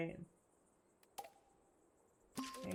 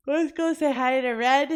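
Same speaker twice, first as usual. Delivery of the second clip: excited; 6 semitones higher, 12 dB louder than usual. Real, not acted. Heading off to raid a streamer.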